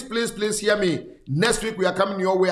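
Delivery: ordinary delivery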